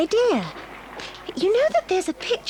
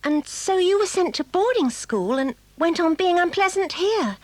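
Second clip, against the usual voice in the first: ordinary talk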